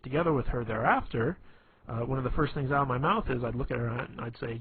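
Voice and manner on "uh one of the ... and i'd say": normal talking voice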